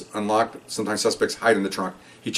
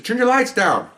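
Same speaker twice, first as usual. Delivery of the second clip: higher called-out command to the car; yelled order; 10 semitones higher, slower than usual. Retold or imitated. Cop orders the car behind to dim its high beams, raised. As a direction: shout it back at the headlights.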